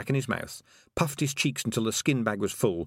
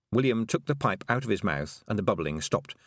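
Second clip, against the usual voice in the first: usual speaking voice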